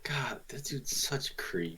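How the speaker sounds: hushed aside; whispering. Real, not acted. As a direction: whisper it with disgust.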